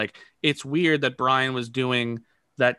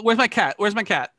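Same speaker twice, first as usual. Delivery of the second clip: high, anxious searching; fretting out loud, pitch raised; worried; 7 semitones higher, 3 dB louder than usual. Acted. Pet owner hunting for a cat, worried. Acted panic over lost cat.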